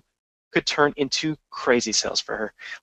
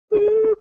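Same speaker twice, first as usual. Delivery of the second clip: a sudden, very high short beep, slightly louder; high beep noise; 5 dB louder than usual. Retold or imitated. Telling how the client's call went dead, high imitated beep. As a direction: mimic a phone line cutting out with a high beep.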